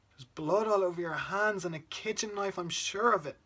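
loud, high, rising distress; horrified. Acted. Child telling Dad about the blood and screaming, horrified. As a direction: blurt out the horror, voice pushed up and loud.

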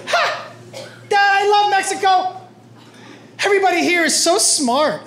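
very high, gushing son voice; excited kid. Retold or imitated. Son gushing to dad about Mexico, excited kid voice.

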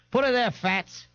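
put-on high-pitched, rowdy tough girl; raucous party girl's jeer; brash beer girl. A narrator's reading. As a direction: greet him with cheeky swagger. Brash beer girl greets Ignatius.

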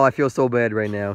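ordinary talk

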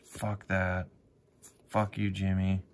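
ordinary talk

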